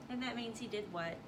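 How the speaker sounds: plain reading voice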